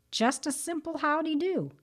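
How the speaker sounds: light high howdy; echoed greeting. Retold or imitated. Preacher echoing the rabbit's wish for a greeting, lightly high.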